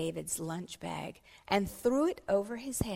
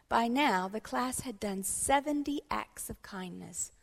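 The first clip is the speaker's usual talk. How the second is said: ordinary delivery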